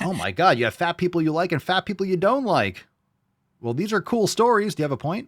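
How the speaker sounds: high mocking sarcasm; smug sarcastic chuckling. Real, not acted. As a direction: sneer at his stories. Mocking the guest's story.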